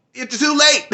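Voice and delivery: high taunting imitation of Peacemaker; mocking Peacemaker. Peacemaker telling the heroes they are late, high taunt. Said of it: "It was like".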